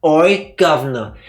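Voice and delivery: a playful British "Oi, governor" with a loud punchy "Oi". Retold or imitated. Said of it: "who talked like that?".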